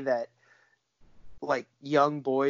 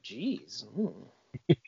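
low, very soft retort; quiet, deflated movie quote; muted mock dismay; 4 semitones lower, 9 dB quieter than usual. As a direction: say it under your breath, imitating him. Fogel's muted reply to teacher.

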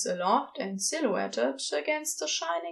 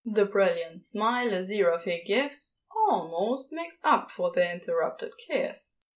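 plain reading voice

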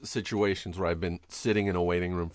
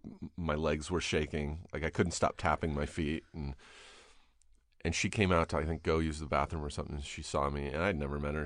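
usual speaking voice

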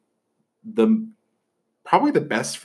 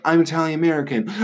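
higher mock voice of a proud Italian American; 7 semitones higher, 3 dB louder than usual. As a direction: lay on the heritage pride in a hammed-up parody. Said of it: "They're like".

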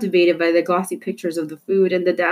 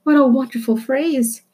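delighted; 8 semitones higher, 3 dB louder than usual. Acted. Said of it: "thought Ulysses".

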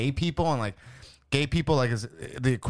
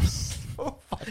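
breathless, slow laugh through so fucking; laughing disbelief; 11 semitones higher, 5 dB quieter, much slower than usual. Real, not acted.

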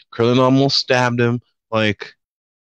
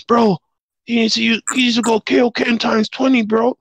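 pitched way up, squeaky sidekick; high squeaky voice; 10 semitones higher than usual. Retold or imitated. Krillin voiced in retelling.

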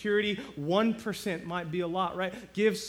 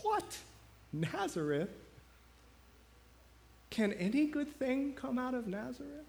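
incredulous scoff; 7 semitones higher than usual. Retold or imitated. Nathaniel's scoffing reply acted.

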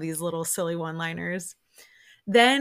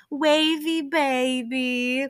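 high, slow mock yell of a goodbye; retold yell; 7 semitones higher, much slower than usual. Mom and daughter yelling from the driveway, high drawn-out mock yell. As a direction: stretch it out high like calling down a driveway. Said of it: "her and her mom both yell".

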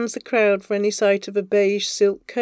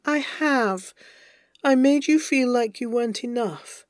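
higher voice, softening into self-blame; remorseful lover; 4 semitones higher than usual. Acted. Avery's anguished apology.

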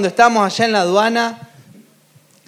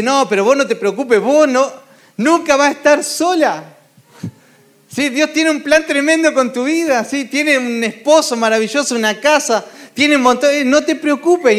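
lightly raised reassurance; 5 semitones higher than usual. Acted. Reassuring a worried friend.

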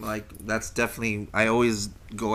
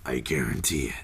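deep announcer voice; 10 semitones lower than usual. Acted. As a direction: drop into a commercial voiceover. Repeats the ad tagline.

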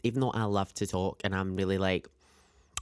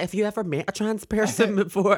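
high, laughing question; 9 semitones higher, 4 dB louder than usual. Retold or imitated. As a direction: ask it through giggles.

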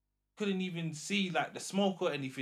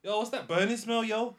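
loud worried friend; 8 semitones higher, 7 dB louder than usual. Retold or imitated. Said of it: "he's like".

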